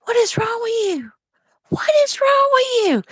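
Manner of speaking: high, squeaky mock disbelief; exasperated. Wife retells scolding her joking husband, high exasperated squeak. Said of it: "And I'm just like".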